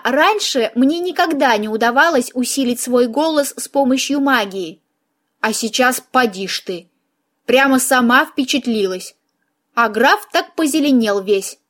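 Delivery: usual speaking voice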